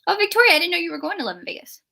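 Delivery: ordinary talk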